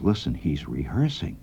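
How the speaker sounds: whispering. Retold or imitated. Al whispering to his friend on the porch, hushed. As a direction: keep it low so the kid won't hear. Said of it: "And he whispers to me".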